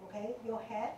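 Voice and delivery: normal talking voice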